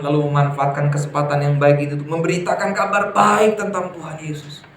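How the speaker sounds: ordinary delivery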